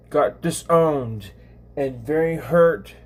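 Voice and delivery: ordinary delivery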